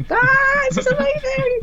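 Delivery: a high, loud, giddy cry of delight; over-excited squeal. Retold or imitated. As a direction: gush like a rookie bouncing off the walls. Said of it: "I was just buzzing".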